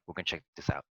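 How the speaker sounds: ordinary talk, nothing marked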